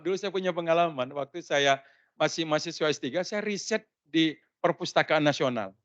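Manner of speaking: usual speaking voice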